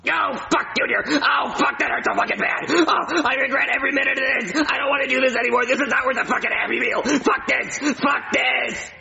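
high-pitched pained shrieking; frantic wailing in agony; agonized screaming. Acted. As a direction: scream through unbearable pain and regret. Kidney removed without anesthesia.

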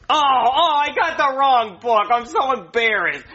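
high, slow, close to tears; mortified wail. Acted. Doctor finds he brought the wrong book, wailing and close to tears. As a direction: wail it like you are about to cry. Doctor realises his mistake.

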